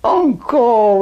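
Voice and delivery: high, loud cheering call; shouting encore. Retold or imitated. Soldiers calling to German cornet player, shouted encore. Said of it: "we're shouting".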